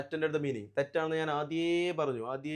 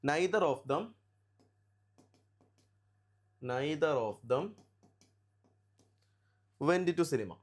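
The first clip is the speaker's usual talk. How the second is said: slow, broken into chunks with long pauses; slow dictation; 3 semitones lower, much slower than usual. Real, not acted. Teacher writing the second sentence for students, slow dictation. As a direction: dictate it piece by piece as you write.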